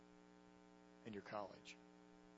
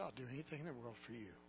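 softly whispered; 3 semitones lower, 7 dB quieter, faster than usual. Retold or imitated. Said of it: "whispered in my ear".

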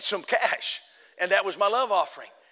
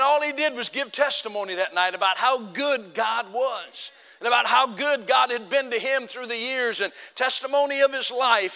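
ordinary talk, nothing marked

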